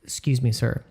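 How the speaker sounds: hushed, fast polite warning; a quiet polite aside about a booger; polite whisper. Retold or imitated. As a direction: whisper it discreetly so nobody else hears. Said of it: "people are very polite and said".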